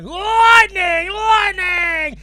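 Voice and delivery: high, full-volume drawn-out yell; wildly overdone high-pitched shouting; shouted lightning call. Acted. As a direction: bellow it like a madman mid-joke. Man in joke starts again.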